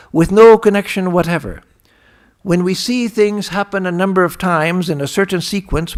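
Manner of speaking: loud, emphatic recitation; emphatic quotation reading. Acted. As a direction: read it out forcefully, point by point. Reads a quotation aloud emphatically.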